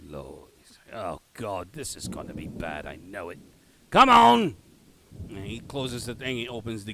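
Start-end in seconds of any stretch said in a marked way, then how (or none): 0.0-3.4 low, soft Cockney grumbling; resigned Cockney aside, pitched down; shitty Cockney accent. Acted. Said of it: "my shitty Cockney accent". 3.9-4.6 loud Cockney gate guard's challenge; rough-and-ready Cockney shout; shitty Cockney accent. Acted. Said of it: "my shitty Cockney accent".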